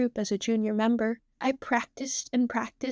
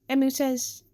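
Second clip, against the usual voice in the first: usual speaking voice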